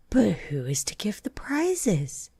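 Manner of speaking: high, light animal voices asking; a crowd of small creatures wondering; chorus of birds. Acted. The birds chorus their question to the dodo in high voices. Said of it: "quite a chorus of voices asked".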